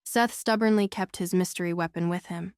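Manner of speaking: ordinary delivery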